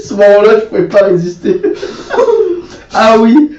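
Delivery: talking through bursts of laughter; helpless high giggles; laughing. Real, not acted. Recalling funny clip, laughing.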